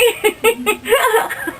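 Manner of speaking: high giggling burst; loud giggle fit; burst of laughter. Real, not acted. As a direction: crack up helplessly. Breaks up laughing mid interview.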